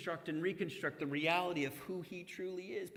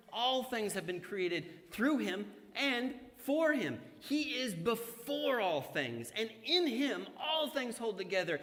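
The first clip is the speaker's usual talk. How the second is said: raised, measured scripture proclamation; preacher reading Colossians to congregation; 5 semitones higher than usual. Acted. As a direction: proclaim the passage to the church. Reads Colossians aloud.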